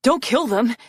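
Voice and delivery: high, loud, urgent plea; yelled plea. A narrator's reading. Arctic pleading with the flying spears, yelling. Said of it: "Arctic yelled".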